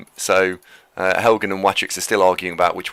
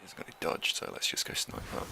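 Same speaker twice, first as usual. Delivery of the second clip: whispering; 17 dB quieter than usual. Real, not acted. Scheming a sneaky snipe.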